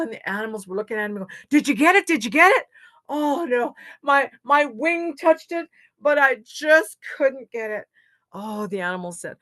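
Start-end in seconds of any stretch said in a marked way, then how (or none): 1.5-2.7 high, loud excited questions; eager questioning. Acted. Animals question Loon at the shore, eagerly. 3.1-7.9 frustrated. Acted. Loon reports failing to animals.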